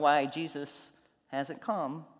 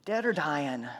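mother's scolding; 10 dB louder than usual. Retold or imitated. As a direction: call his name like a mom catching him out. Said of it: "that voice".

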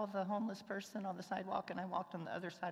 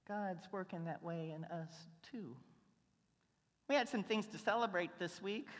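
usual speaking voice

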